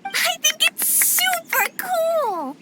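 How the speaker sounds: high, drawn-out squeal of approval; bubbling, high-pitched excitement; thrilled. Acted. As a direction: gush it, stretching the big words. Child thrilled by the group plan.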